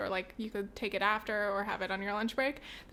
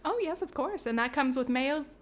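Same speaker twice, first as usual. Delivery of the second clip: cashier voice. Pretend. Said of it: "I'll fucking do it".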